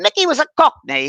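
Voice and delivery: put-on high-pitched Cockney accent; Mickey. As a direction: mimic a Cockney spirit guide. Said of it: "He talked like that".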